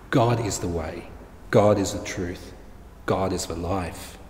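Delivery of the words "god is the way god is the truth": normal talking voice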